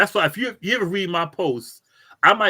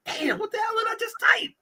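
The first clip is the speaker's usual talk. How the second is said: much higher, fast, startled shout; shocked outburst; faster than usual. Retold or imitated. Rereading his own garbled post hours later, startled shout. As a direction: yell in horror at your own typo. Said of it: "I'm like".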